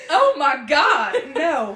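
very slow laughing Oh my God; laughing outburst. Real, not acted. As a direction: burst out laughing in shock.

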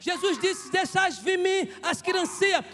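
high, shouted pulpit oratory; full-force preaching. Real, not acted. Preacher telling the church everyone has broken mugs, shouted preaching. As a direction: hammer each phrase out to the congregation.

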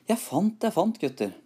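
very high, eager cry; high cry of discovery, dropping at the end; excited calling out. A narrator's reading. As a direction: call the others over, pitched right up. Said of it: "ropte han".

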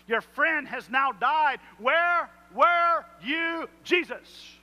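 drawn-out pleading call to Jesus; anguished drawn-out plea. Acted. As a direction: cry out the question in anguish. Disciples confront Jesus over death.

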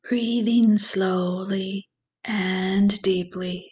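slow, calm meditation voice with long pauses; guided meditation. Acted. Guide leads listeners through breathing and visualization, slow meditation voice. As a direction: ease the listener gently into relaxation, unhurried and even.

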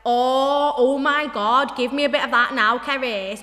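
broad, loud regional British accent; old high-school accent. Shown as a demonstration. Showing a friend their teenage self, broad British school accent. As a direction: lay the home British accent on thick. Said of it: "how my accent used to be when I was in high school".

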